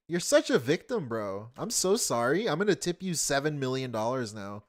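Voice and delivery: ordinary talk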